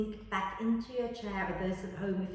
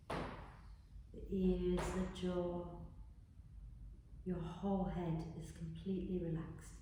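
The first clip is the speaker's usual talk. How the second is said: guided meditation; 8 dB quieter than usual. Acted. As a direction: guide them gently into relaxing. Leads a body relaxation.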